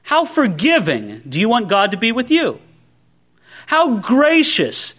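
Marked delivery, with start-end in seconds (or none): none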